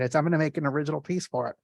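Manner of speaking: fast, tumbling excited words; excited fast talk. Real, not acted. Telling the art program about his new painting, rushing his words. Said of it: "I'm talking really fast. I get excited".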